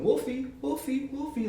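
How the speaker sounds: put-on high drunken sing-song Wolfie; mock drunk wrestler calling Wolfie; drunk Marty calling. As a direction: croon it high like a happy drunk.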